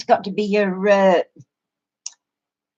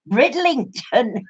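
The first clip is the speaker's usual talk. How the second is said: normal talking voice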